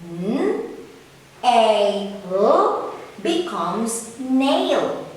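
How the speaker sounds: slow blended phonics, each sound held; blending sounds. Shown as a demonstration. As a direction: sound out N A L slowly, then say "nail". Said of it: "Now let's combine all the sounds".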